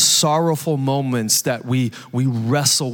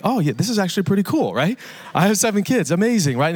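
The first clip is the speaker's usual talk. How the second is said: bright, fast, higher mock delight; joy-filled reply; 7 semitones higher, faster than usual. A parent of seven copying a delighted stranger's praise, fast and excited. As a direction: act out a stranger's happy amazement. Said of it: "One's more joy-filled".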